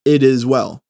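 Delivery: ordinary talk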